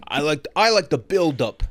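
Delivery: put-on slow, thick Brooklyn accent; Callum Turner Brooklyn accent. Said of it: "my impression of Callum Turner doing a Brooklyn accent".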